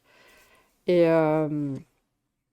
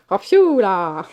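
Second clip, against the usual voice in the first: hammed-up Belgian exclamation; mock Belgian accent; 4 dB louder, much slower than usual. Joking with friends about a film detective, mock Belgian accent. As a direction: play up a caricature Belgian accent. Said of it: "l'accent anglais qui imite l'accent belge".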